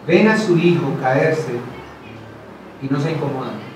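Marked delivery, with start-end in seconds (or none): none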